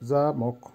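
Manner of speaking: জামক in two separated parts, za-mok; careful pronunciation. Shown as a demonstration. Teacher repeating the word for lock, carefully pronounced. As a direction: sound out জামক as za, then mok. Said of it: "উচ্চারণ হবে".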